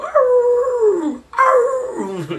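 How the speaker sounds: high, yelping dog howl; a bad but earnest impression of a dog howling. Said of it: "he hears his dog go".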